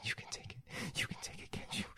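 hushed, very soft whisper; whispered encouragement. Acted. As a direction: whisper it close into the listener's ear. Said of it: "I'm whispering dirty encouragements in your ear".

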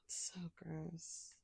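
slow, hushed mock disgust; breathy disgust. Teasing a partner, hushed mock disgust. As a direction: whisper it slowly, as if grossed out.

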